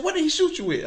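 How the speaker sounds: high, loud retold disbelief; astonished question. Man hearing that the caller was shot, astonished. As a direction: imitate his shocked, high-pitched question.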